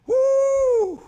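high loud whoop of praise; joyful whoop. Real, not acted. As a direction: let out a big happy woo. Preacher whooping after scripture.

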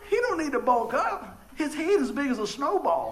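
high-pitched mock protest; retold exasperated rebuttal; incredulous objection. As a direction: argue back in comic disbelief. Acts out his objection to wife.

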